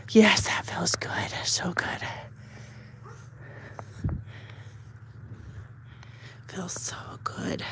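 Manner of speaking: soft unvoiced whisper while brushing; whisper to dog. Real, not acted. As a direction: whisper soothingly as you brush.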